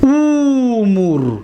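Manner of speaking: امور spoken slowly and clearly; slow drawn-out model word. Shown as a demonstration. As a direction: stretch امور very slowly, each sound held. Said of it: "دقت دادید؟".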